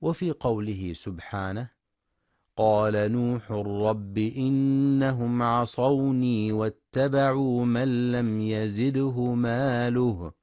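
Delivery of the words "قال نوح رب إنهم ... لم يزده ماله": slow, louder melodic tajweed chant; Quran recitation. Acted. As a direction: chant the verse with long held vowels.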